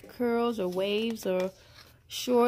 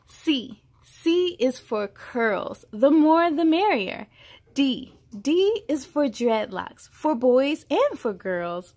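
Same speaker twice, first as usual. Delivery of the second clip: put-on bright, measured read-aloud voice for a child; storybook reading; 2 semitones higher, 5 dB louder, slower than usual. A narrator's reading. Reading a hair alphabet book aloud to a child, storybook voice. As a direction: read each line out like a picture book.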